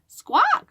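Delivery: very high squawk; parrot squawk. Acted. Reader imitating a parrot for the kids, high squawk.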